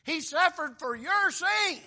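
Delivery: high, loud, drawn-out proclamation; full-force preaching. Real, not acted. Preacher proclaiming Christ's suffering to congregation, full-force preaching.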